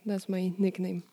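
ordinary delivery

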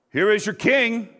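high, loud announcement; mocking proclamation. A narrator's reading. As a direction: call it out with scorn. Pilate presents Jesus again.

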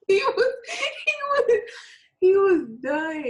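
high, loud words through laughter; laughing. Real, not acted. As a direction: crack up while trying to get it out. Cracks up retelling the scene.

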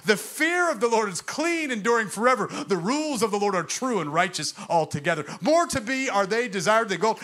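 loud, rising psalm recitation; preaching at full force. Acted. Preacher to congregation, loud ringing recitation.

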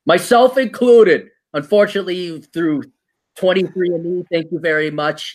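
ordinary delivery

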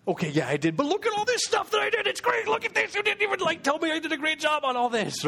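mock-whine like a hurt employee; defensive whining. Defensive reply to boss, retold.

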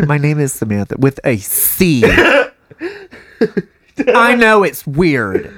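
put-on Valley Girl voice. Customer spelling her name.

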